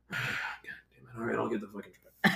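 a hint of an exasperated father sighing it out; dad's voice, fed up. Dad hearing the truck flipped, mildly fed-up groan. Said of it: "Can you actually do your dad's voice".

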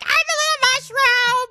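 squeaky high mushroom voice; piping tiny falsetto creature; squeaky falsetto mockery. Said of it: "I'm like".

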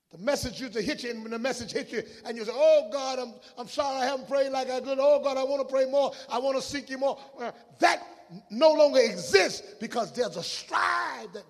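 high, loud, urgent sermon delivery; fervent preaching with pleading outbursts; full-force preaching. Real, not acted. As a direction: build to a shouted pulpit cry.

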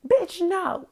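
sudden high, loud rebuff; indignant refusal. Acted. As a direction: throw it back loudly in mock outrage. Acted reply to imagined plea.

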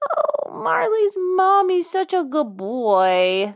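put-on high, slow, drawn-out baby talk; cooing pet talk. Host giving a silly shout out, high slow cooing pet voice. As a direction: coo it as if to a dog.

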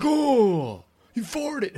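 high, prim disapproval; mock a prudish coworker; prissy scold.

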